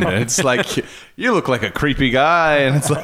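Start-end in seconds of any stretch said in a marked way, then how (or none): 1.2-2.6 mock American accent. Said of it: "bad American approximations".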